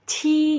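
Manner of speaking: "ci" as c with audible air, held long; aspirated Q sound. Shown as a demonstration. Teacher showing how Mandarin Q is read, aspirated. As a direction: let air rush through "ci". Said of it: "terus ada suara udara".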